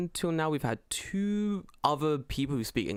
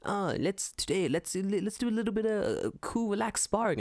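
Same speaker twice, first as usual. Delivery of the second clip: put-on halting Japanese-accented English; 8 semitones higher than usual. Sparring partner inviting a friend in halting Japanese-accented English. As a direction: mimic chill, Japanese-accented learner English. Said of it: "kind of spiky English".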